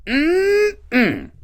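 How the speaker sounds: high, drawn-out "mm-mm" of relish; savouring hum. Pretend. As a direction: hum as if tasting delicious food. Savouring imagined catfish.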